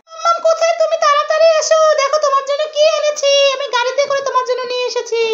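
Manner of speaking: put-on high, eager kid call; child calling mommy. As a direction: call out like a toddler with a surprise. Parent voices child calling mother.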